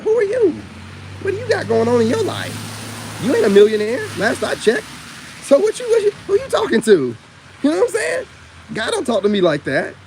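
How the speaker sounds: loud, high, heated callout of preachy readers; venting at self-styled prophets, loud and high; indignant rant. Real, not acted.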